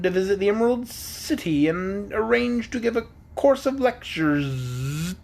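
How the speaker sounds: high, slow and pompous bug; Wogglebug voice. A narrator's reading. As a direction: draw it out like a vain lecturer.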